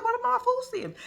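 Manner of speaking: angry about losing her benefits; mock outrage. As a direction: squeal the indignant question. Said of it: "she was hot".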